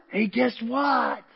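drawn-out, teasing news-breaker; mock excited announcement. Groom calling his brother on wedding night, mock excited. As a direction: stretch it out like big news.

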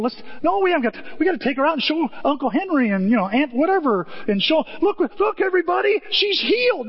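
high, rushing family chatter; excited family. Retold or imitated. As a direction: hurry to show everyone.

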